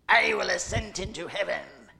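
a vain angel bragging to the sky; Lucifer's voice. Acted. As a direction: grandiose mock Lucifer. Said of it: "that's how he sounds, right?".

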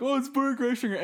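high-pitched mock fan excitement; imitating a starstruck crowd; mock excited fan. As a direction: gush it like everyone recognizing a celebrity. Acting out excited fans' reaction.

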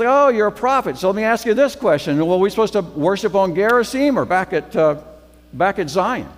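high, loud, pushy questioning; feisty challenge. Retold or imitated. Samaritan woman testing the prophet at the well, high and pushy. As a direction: put the prophet on the spot.

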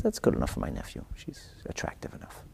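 hushed, breathy whisper to himself; a king sizing her up in a quiet whisper; whispered aside. Retold or imitated. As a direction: say it under your breath like a secret. King's private aside about peasant girl.

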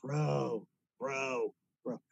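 low, drawn-out mock "bro"; mocking imitation. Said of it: "There he goes again".

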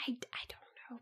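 a soft unvoiced whisper. Real, not acted. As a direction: breathe it out, creeped out. Creeped out by prank.

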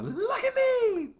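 very high, slow and loud scream; shouting. Retold or imitated. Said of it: "she was like".

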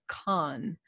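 con with an open "ah" vowel. Shown as a demonstration. Challenging the co-host on spelling, con as "kahn". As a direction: say con as "kahn", not "can". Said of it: "words that are spelled C A N".

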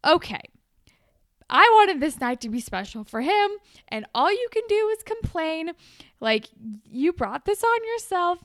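mock scolding. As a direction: hand it to her, fed up. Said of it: "Hazel is like".

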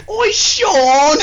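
very high, loud Aussie shriek; high-pitched Australian. Retold or imitated. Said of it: "I'll try, fuck it".

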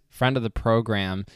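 ordinary talk, nothing marked

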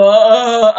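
put-on loud stuck ah ah ah of a frozen teen; frozen stammer. Nervous girl freezing in front of her crush, stuck stammering ahs. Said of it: "no hablaba, me quedaba".

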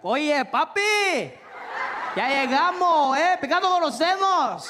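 high, loud Spanish with a laugh; Puerto Rican guy. Retold or imitated. A Puerto Rican stranger at the airport greets him, loud and high in Spanish. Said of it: "some guy came up to me and he was like".

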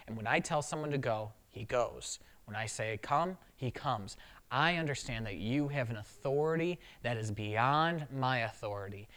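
a soldier bowing his head, speaking low and softly; the centurion humbly asking Jesus to just say the word; humble plea. Retold or imitated.

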